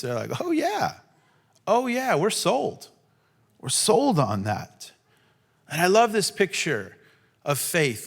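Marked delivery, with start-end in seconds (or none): 0.4-4.9 eager yes. Pretend. Eager agreement of blind men.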